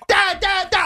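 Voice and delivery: put-on high, insistent kid's voice; pestering high-pitched calls; child calling Dad. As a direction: imitate a child nagging through the door, high and repetitive. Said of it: "then I get constant knocking".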